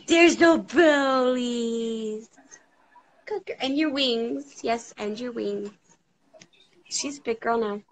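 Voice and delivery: put-on slow, sing-song baby talk; mother quizzing her toddler, sing-song. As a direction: coax the baby gently. Quizzing baby on body parts.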